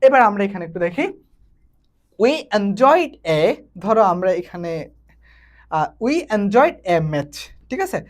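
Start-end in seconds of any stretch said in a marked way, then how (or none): 2.2-3.4 very slow, each English word spaced out; slow dictation. Shown as a demonstration. Teacher dictating an example sentence to students, very slow. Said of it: "teacher reads example sentence". 6.0-7.4 very slow, each English word spaced out; slow dictation. Shown as a demonstration. Teacher dictating an example sentence to students, very slow. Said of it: "teacher reads example sentence".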